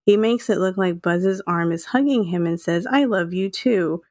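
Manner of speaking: ordinary talk, nothing marked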